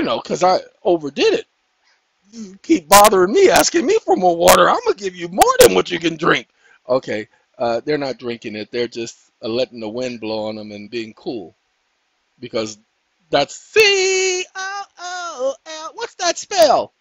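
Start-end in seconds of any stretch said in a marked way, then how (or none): 2.3-6.5 louder, playful warning; playful loud warning to the dog; mock threat to the dog. Real, not acted. Scolding dog asking for water. 13.6-16.9 high, slow letter by letter; high, slow sing-song spelling; playful spelling out. Pretend. Spelling cool for the dogs.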